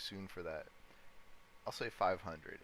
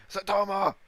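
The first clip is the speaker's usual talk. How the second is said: "Saitama" shouted in a mock aggressive Japanese accent, much higher and louder; 12 semitones higher, 16 dB louder than usual. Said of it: "An aggressive Japanese accent?".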